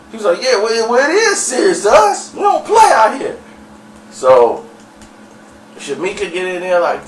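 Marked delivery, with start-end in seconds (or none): 0.4-3.4 high, loud indignant comeback; heated retort. Retold or imitated. Local dude warning the visitors it is serious, heated retort. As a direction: push back hard, voice pitched way up. Retold angry confrontation, insisting it's serious.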